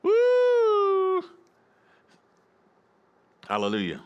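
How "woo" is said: high, loud sustained woo; exuberant whoop. Real, not acted. Pastor celebrating after urging folks to church, exuberant whoop.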